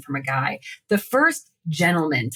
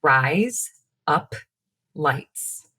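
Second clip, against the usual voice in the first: three separate words, spaced out; slow demonstration; 3 semitones lower, much slower than usual. Shown as a demonstration. Host tells co-host to repeat after her, slow demonstration. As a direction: lay out each word slowly to be copied. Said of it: "repeat after me".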